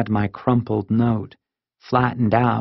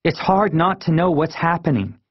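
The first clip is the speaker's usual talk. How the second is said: higher-pitched hurt protest; angry; 7 semitones higher than usual. A narrator's reading. Kid confronting parents left in the dark, angry. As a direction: complain crossly in a raised pitch. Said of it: "I sounded so angry".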